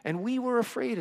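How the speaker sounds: high, defensive, flustered disciples; whiny excuse. Pretend. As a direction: babble excuses in a high, squeaky whine. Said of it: "And they're like".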